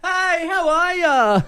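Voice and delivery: high, slow, bright hello to a friend; cheery greeting. Retold or imitated. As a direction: call out a drawn-out friendly hello. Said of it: "hear someone behind me go".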